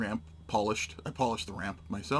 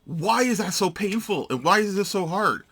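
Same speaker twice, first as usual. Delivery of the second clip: exasperated; 7 semitones higher, 6 dB louder than usual. Real, not acted. Exasperated after hurting finger.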